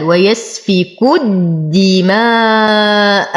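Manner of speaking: slow ويسفك الدماء, doubled dal and very long aa; long madd. Shown as a demonstration. Teacher reciting for students, ويسفك الدماء with long madd. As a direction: stretch الدماء up to its closing hamza. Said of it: "مد واجب متصل يمد بالإشباع".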